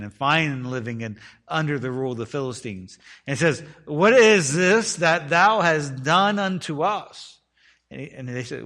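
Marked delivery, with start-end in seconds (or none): 3.8-7.4 slow, loud, higher-pitched scripture accusation; accusing. Retold or imitated. Men of Judah confronting Samson over what he did, accusing. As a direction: draw out the reproach, loudly.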